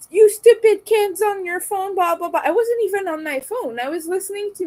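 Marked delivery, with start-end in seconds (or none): 0.1-2.4 high, loud mocking voice of the rude man; mock the stranger's scolding, loud and high; grumpy old man insulting. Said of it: "and, like".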